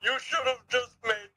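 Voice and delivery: very high, haughty voice with gloating ho-ho laugh; posh voice. Acted. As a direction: sneer down at the poor player. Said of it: "the posh voice?".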